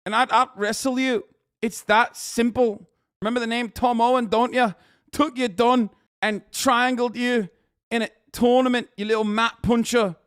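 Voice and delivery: put-on high, loud taunting English lad accent; mock English lad. Quoting the wrestler taunting Muhammad, in a mock English accent. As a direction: jeer at him in a hammed-up English lad accent. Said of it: "He said".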